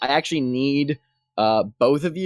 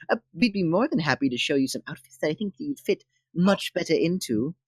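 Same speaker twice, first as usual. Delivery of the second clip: higher, softly gushing temple devotee; excited greeting; 4 semitones higher, 4 dB quieter than usual. Acted. Devotee advising a newcomer on outfits, politely excited. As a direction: welcome them eagerly in a put-on, higher voice. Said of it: "they greet you very excitedly".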